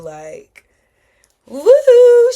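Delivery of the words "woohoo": loud, high-pitched woohoo; celebratory whoop. Real, not acted. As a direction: let out a big elated whoop. Celebrates her growth out loud.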